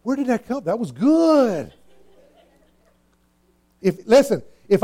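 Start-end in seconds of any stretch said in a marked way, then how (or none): 0.0-1.7 amazed at himself. Retold or imitated. Preacher recalling surprise at something he said, amazed. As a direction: marvel at your own words. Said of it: "and I'm going like".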